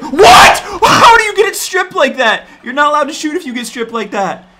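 high, loud disbelieving shout; outraged yell. Real, not acted. Gamer screaming at his player getting stripped, outraged. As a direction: scream at the game's injustice.

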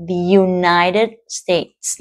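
"The United States" drawn out very slowly. Shown as a demonstration. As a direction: spell it out syllable by syllable. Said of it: "nước Mỹ đúng không". (đọc rất chậm từng âm tiết)

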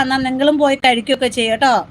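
ordinary talk, nothing marked

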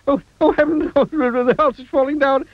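very high, hysterical wailing; panicked cry. A narrator's reading. Mister Jelly thinks the world is ending, panicked cry. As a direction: work up into a terrified wail. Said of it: "he cried".

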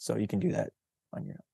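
ordinary delivery, nothing marked

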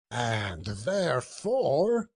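very slow, rising lead-up; suspenseful drawl. A narrator's reading. Boss teasing his clerk before the verdict, suspenseful. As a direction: stretch it out and leave it hanging. Scrooge stretches out his mock threat.

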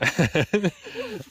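loud laugh. Real, not acted.

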